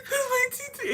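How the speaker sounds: high, wailing cry through the words; mock sobbing plea; imitating crying. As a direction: blubber it out like a crying kid. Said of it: "I remember crying".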